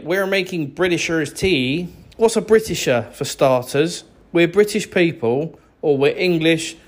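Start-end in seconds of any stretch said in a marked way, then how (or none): 0.0-1.9 mocking American. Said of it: "don't make a video saying".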